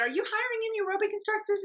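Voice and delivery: high, fast eager question. Retold or imitated. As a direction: sound young and hopeful. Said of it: "And I said".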